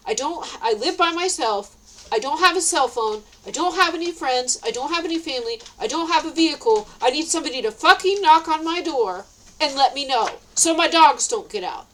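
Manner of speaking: heated, emphatic listing of grievances; mad. Retold or imitated. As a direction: build from exasperated list to angry demand. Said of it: "I was mad".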